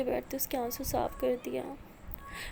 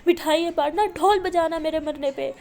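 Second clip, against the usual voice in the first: much louder, higher furious yell at her mother; angry screaming; 4 semitones higher, 9 dB louder than usual. Acted. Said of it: "غصے میں چیختی ہے". (غصے میں چیخنا)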